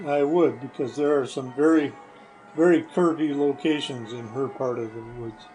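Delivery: normal talking voice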